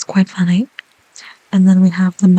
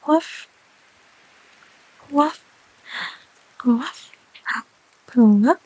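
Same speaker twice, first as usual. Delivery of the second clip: high, soft repeated woofs; little woof; 4 semitones higher, 4 dB quieter, much slower than usual. Acted. Dog teaching viewer to woof, soft yips. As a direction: yip it softly, over and over.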